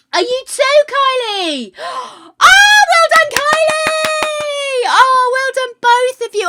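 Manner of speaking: high-pitched gleeful shrieking; gasp, then squeal the praise at full voice; overexcited congratulations. Real, not acted. Streamer finding that Kylie was promoted too, overexcited squeal. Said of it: "I get overexcited".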